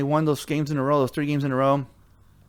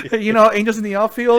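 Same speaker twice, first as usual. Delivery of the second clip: higher, louder laughing line; 8 semitones higher, 6 dB louder than usual. Real, not acted. Joking about a late-game miracle, laughing. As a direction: laugh at his own hopeless hope.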